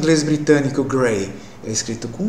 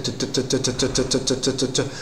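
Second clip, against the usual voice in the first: put-on soft clipped tut tut like an old car motor; old engine putter; 5 dB quieter than usual. Shown as a demonstration. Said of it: "o motor fazia tut".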